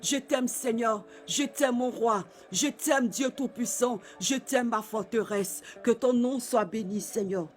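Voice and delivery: closing a prayer in hushed adoration; tender whispered love. Real, not acted. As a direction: murmur your love to God, intimate and hushed. Intimate closing of worship prayer.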